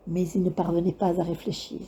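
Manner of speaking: ordinary delivery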